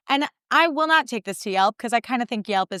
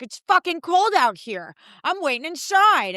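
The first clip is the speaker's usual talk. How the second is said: higher, slow, put-upon dad voice; grumbling dad; 8 semitones higher, slower than usual. Retold or imitated. Dad refusing to wait outside in the cold, grumbling. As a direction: complain like a stubborn father.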